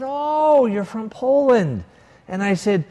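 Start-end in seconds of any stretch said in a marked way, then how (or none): 0.0-1.8 delighted echo. Retold or imitated. Echoing her answer, delighted.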